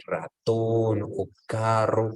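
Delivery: "ratón o carro" with firmly rolled Spanish R; Spanish trilled R, clearly pronounced in "ratón o carro"; strongly marked Spanish R. Shown as a demonstration. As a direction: roll the R hard in "ratón" and "carro". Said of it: "nuestra R es muy marcada".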